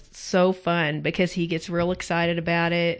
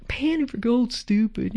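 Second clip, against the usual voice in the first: whining; 5 semitones higher than usual. Retold or imitated. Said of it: "they could easily be whining".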